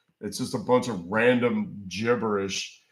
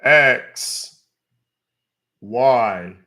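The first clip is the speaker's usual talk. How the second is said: loud dictating; 5 dB louder, much slower than usual. Real, not acted. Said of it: "Can you repeat again".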